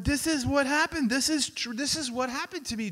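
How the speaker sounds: high, breathless-with-wonder repetition; excited discovery. Retold or imitated. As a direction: imitate a man bursting with amazed recognition. New reader's astonished discovery, retold.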